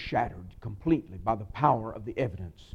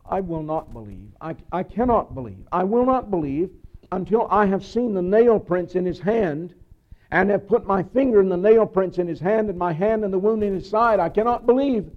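starts level, climbs higher and louder to an anguished plea; doubting Thomas, building to a cry; 6 semitones higher, 8 dB louder than usual. Acted. Preacher voicing Thomas's refusal to the disciples, rising to a loud anguished cry. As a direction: Thomas pleading his disbelief, growing more desperate with each line.

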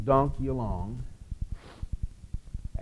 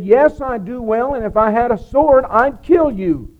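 loud, higher angry threat; furious threat; 9 semitones higher, 9 dB louder than usual. Acted. Enraged Balaam threatening his donkey, loud and furious. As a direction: spit the threat out at full volume. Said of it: "he is so angry".

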